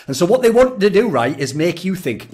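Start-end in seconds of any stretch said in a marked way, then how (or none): none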